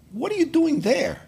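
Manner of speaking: high, astonished question, then a laugh; amazed question. Retold or imitated. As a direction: ask it as if you can't believe it, then laugh. Old acquaintance amazed to see him.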